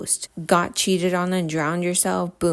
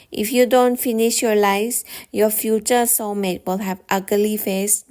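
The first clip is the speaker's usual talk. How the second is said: put-on Thai mom scolding, Thai accent; lightly imitating a Thai mom's accent; 3 semitones higher than usual. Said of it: "Thai moms say".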